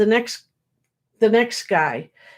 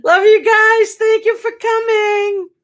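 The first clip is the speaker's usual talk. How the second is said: fond goodbye; 12 semitones higher, 5 dB louder than usual. Real, not acted. Warm goodbye to viewers.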